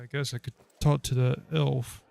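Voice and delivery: usual speaking voice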